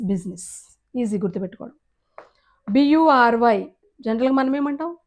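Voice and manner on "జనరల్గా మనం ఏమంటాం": ordinary delivery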